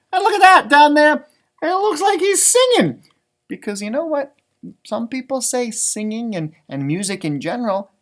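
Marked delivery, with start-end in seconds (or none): 0.1-3.1 higher and louder, delighted to spot it; excited. Real, not acted. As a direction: point it out with a burst of delight. Pointing out picture to children.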